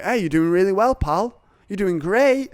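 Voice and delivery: high, chirpy mock encouragement; hammed-up high-pitched cheerleading; gushing praise. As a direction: overdo the friendly pep talk. Mimics constant easy praise, for contrast.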